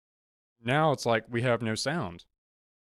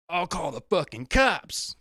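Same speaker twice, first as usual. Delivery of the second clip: high, slow whiny mock threat; mocking the promoter; 6 semitones higher, slower than usual. Teller mocks the promoter threatening to call cops. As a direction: sneer it like a petty promoter.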